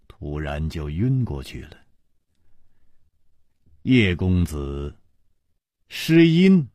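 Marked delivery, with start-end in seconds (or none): none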